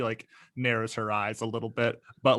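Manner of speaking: ordinary delivery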